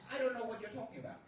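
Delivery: rushed, tumbling out fast; flustered blurt. Acted. Bewildered host hurrying his reply to the priest, rushed. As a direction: spill the words out in confusion.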